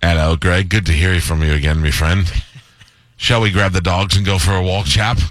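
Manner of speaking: low, chummy British butler; mock British Siri. Siri as Greg's friend proposing a walk, mock British. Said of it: "you just want him as a friend".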